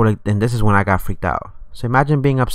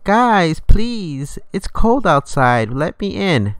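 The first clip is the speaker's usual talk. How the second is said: put-on high, drawn-out child's plea; pleading little voice; 8 semitones higher, much slower than usual. Little kid outside begging to be let in, high pleading voice. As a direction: imitate a little kid begging, higher and stretched out. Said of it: "I did hear a little voice".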